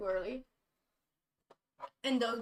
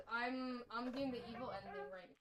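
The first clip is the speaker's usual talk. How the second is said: ordinary talk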